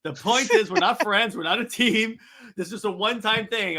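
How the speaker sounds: high, loud exasperation; Green Lantern. Acted. Hero rejects being on a team, high exasperated insistence. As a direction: insist on it, worked up and emphatic. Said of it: "Green Lantern goes".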